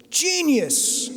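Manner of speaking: high-pitched mock scream of one word; screamed genius. Chairman of the publishing house screams his approval down the phone: high mock scream. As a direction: belt it out like a thrilled Italian chairman. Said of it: "screams out the word so loudly".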